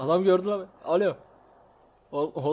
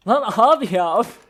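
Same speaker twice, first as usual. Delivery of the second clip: frustrated outburst; 6 semitones higher, 11 dB louder than usual. Real, not acted. Fails a jump.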